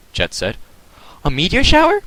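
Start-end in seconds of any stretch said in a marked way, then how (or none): 1.2-2.0 high surprised question; startled. A narrator's reading. Jet spotting a meteor shower, high surprised question. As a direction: shoot up in disbelief.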